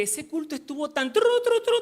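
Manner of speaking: high, loud nonsense in place of swearwords; excited retold gushing, pitch shooting up; mock cursing. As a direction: imitate foul-mouthed raving with trilled filler sounds. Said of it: "en malas palabras".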